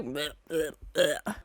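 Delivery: low, soft retching "blech"; repeated little noises of revulsion; disgusted blech. Real, not acted. Viewer picturing a floating eyeball behind her, disgusted blech.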